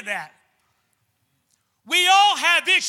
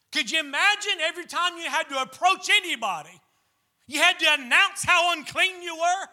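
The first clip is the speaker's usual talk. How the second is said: ordinary talk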